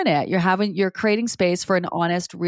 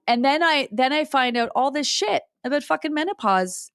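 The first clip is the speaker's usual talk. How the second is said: ordinary delivery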